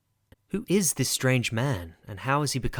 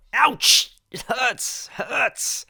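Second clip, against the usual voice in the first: put-on hurt child; 11 semitones higher, 4 dB louder, much slower than usual. Child hurt by knotted string.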